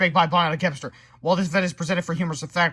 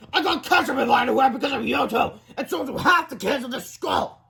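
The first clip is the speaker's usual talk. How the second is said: angry yelling; 9 semitones higher than usual. Acted. Principal yelling at boys.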